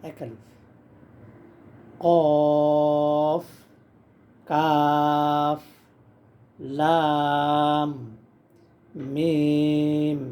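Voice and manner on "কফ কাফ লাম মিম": কাফ, লাম, মিম each drawn out slowly and a bit louder; held long recitation. Shown as a demonstration. Teacher chanting letter names for beginners, long. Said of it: "মিম চার আলিফ".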